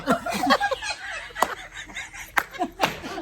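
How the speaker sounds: long high-pitched laugh; real helpless fit of giggles. Real, not acted. Friends joking over Diwali snacks, long high laughter. Joking about the daughter-in-law.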